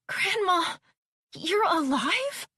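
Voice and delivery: high-pitched, disbelieving question; astonished question. A narrator's reading. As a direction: ask it in amazement, voice lifted way up. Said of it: "Don't say it like it's a question".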